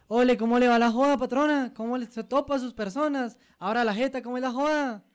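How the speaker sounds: loud, high Santander street talk; hammed-up Santander slang, shouted high; Santander accent. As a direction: bring the rowdy Santander swagger. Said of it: "no somos los mejores imitando esos acentos".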